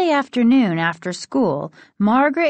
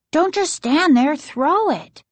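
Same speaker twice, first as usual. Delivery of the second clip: high-pitched, impatient urging; 9 semitones higher than usual. A narrator's reading. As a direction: urge a friend to hurry up and throw. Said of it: "Casey urged".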